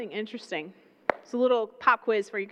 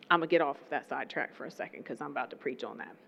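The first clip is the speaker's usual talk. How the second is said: pulling back; 12 semitones lower, 10 dB quieter, faster than usual. Real, not acted. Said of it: "I'm gonna calm down".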